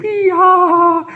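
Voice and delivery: high, loud squealing yee-haw; squeal of joy. Retold or imitated. Fluffy animal gets a present, loud high squeal. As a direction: squeal it like a thrilled little critter. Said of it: "squeals with joy and he's like".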